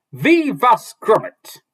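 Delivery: high, loud, slow dwarf voice; dwarf introduces himself, high, loud and slow; dwarf blurting. Acted. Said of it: "the dwarf blurted out".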